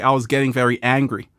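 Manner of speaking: ordinary talk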